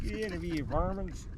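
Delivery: high, hushed hillbilly holler, hammed up; mock backwoods gunman. Man in long johns shaking gun at intruders, mock yokel. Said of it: "I come out shaking my gun going".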